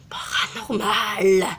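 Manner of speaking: slow, spooky drawn-out word; disguised deep voice. Acted. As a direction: draw out paranormal spookily. Spooky voice for effects.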